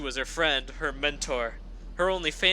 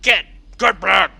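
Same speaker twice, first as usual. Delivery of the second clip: guard yelling; 4 semitones higher, 12 dB louder than usual. Acted. Suits yelling at ponies.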